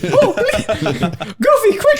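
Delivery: very high falsetto cartoon squeal, loud; Mickey Mouse. Comic playing himself caving, squeaky cartoon panic. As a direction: beg for rescue in a squeaky mouse voice. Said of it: "Turn into Mickey Mouse".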